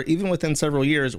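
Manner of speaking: normal talking voice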